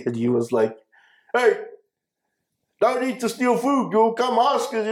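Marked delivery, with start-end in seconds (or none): 1.3-4.9 high mock scolding voice; big Panther voice. Lucky telling the kids not to steal food, mock scolding.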